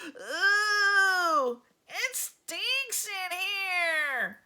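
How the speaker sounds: high, whiny "ooh, it stinks" with a drawn-out complaint; disgusted girl. Acted. Girl in class groans at the stink, high and whiny. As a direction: squeal it in disgust, slowly. Maurecia complaining about the smell.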